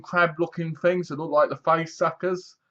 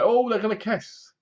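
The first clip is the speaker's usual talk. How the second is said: much higher, teasing sing-song; mock excited; 7 semitones higher than usual. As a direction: tease like expecting a kiss. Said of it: "and you're like".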